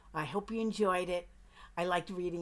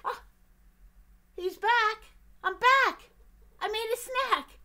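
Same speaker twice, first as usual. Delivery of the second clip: high, loud, bursting back in; excited duck; 12 semitones higher, 9 dB louder than usual. Acted. Duck bursting back in with snacks, high and loud. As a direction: announce your return like a thrilled duck.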